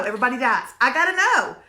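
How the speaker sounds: usual speaking voice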